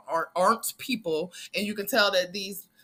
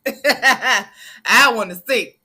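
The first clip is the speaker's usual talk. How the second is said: slow laughing plea, louder; laughing, nosy; 3 semitones higher, 9 dB louder, much slower than usual. Real, not acted. Vlogger to viewers, teasing and nosy, laughing slowly. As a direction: drag it out through a laugh.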